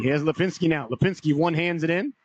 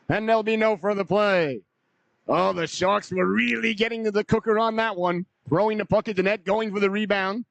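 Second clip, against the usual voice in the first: higher-pitched, lingering excitement after the chance; still excited; 5 semitones higher than usual. Real, not acted. Commentator reviewing the near goal, still excited and high. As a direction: keep the pitch raised as the rush settles.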